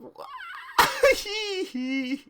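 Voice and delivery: a high, shrieking burst of laughter; squealing laugh that cracks high. Real, not acted. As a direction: lose it completely, shrieking. Laughing fit while reading fanfic.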